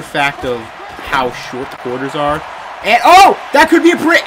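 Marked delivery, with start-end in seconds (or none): 2.8-4.2 loud, high rising excitement; thrilled yell; excited shout. Real, not acted. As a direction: cheer as the play breaks open. Big play developing.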